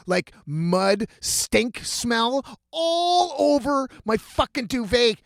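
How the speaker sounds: normal talking voice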